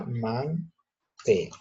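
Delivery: "mantel" split in two, weight on "tel"; stress on final syllable. Shown as a demonstration. Teacher giving a word without accent mark, stressed "tel" in "mantel". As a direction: break "mantel" as man-TEL. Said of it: "guíate por la mayor fuerza de voz".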